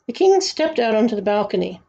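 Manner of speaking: ordinary delivery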